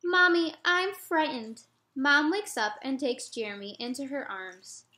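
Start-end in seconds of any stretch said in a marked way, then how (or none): 0.0-1.6 put-on higher, louder child's call; a scared boy waking his mom; frightened child. Jeremy waking his mother at night, high scared child voice.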